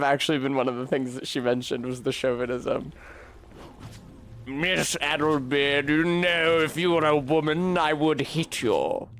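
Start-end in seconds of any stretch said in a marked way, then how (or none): 4.5-9.1 slow, lightly voiced old British lawyer; a hint of Churchill, unhurried. Streamer quoting a line from the old movie in a mild Churchill voice. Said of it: "I'm just doing my Churchill".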